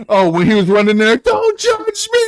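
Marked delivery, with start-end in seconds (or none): 1.2-2.2 very high, drawn-out imitated cry; mock defensive squeal. Quoting the character running, high defensive squeal. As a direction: squeal it in a hammed-up film quote.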